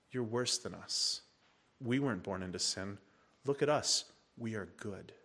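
soft, low, scornful condescension; contemptuous. Retold or imitated. Pharisees belittling the born-blind man, soft and scornful. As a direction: drip with quiet contempt. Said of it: "Can you hear the hell dripping out of their mouth?".

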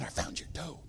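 very soft, almost whispered confession; sheepish repeat. Retold or imitated. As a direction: barely voice it, hushed. Boss sheepishly repeats his news.

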